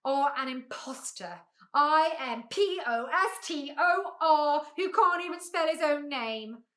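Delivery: loud, indignant spelling-out; excited shout. A narrator's reading. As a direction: denounce him letter by letter. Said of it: "shouted the bee very excitedly".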